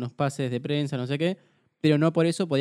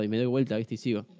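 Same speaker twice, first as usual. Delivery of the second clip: ordinary talk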